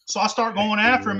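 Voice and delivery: usual speaking voice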